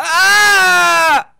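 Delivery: high, loud, held scream; sustained high yell; long scream. Real, not acted. Gamer tries a risky move, long scream.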